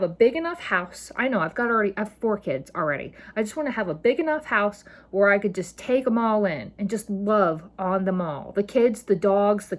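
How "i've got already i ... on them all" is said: ordinary talk, nothing marked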